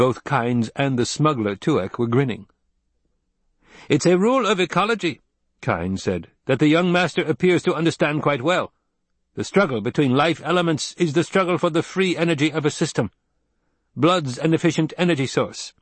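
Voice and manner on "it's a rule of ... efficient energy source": lightly voiced ecologist. A narrator's reading. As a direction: explain it like a field scientist. Kynes joins in.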